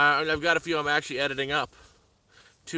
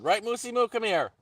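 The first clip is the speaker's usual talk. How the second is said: pet voice; 10 semitones higher, 3 dB louder than usual. Real, not acted. Owner cooing to his puppy, in a high pet voice.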